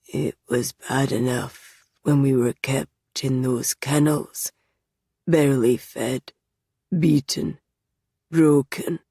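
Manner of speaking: flat, even, slow recital; monotone. Acted. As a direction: list it without feeling. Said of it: "Kai's tone is monotonous".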